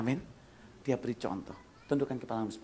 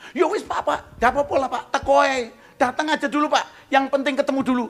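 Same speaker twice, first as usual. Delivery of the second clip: pitched-up, loud eager pleading; eager high voice; 14 semitones higher, 13 dB louder than usual. Retold or imitated. Host on the phone urging the pastor, eager and loud. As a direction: coax him to just come.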